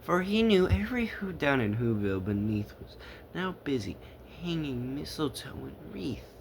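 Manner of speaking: plain reading voice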